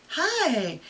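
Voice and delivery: high, sweet phone hello; sweeter voice. Shown as a demonstration. Demo of the sweet phone hello for students. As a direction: pitch the hi up sweetly. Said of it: "It was my sweeter voice".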